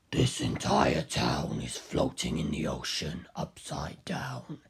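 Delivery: deep, slow, menacing giant; towering fighter greets the hero in a deep slow voice; Kaz voice. A narrator's reading. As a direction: loom over him with a low slow growl. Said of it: "Carl said".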